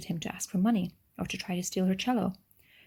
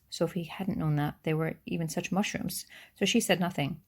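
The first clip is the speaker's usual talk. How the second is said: ordinary delivery, nothing marked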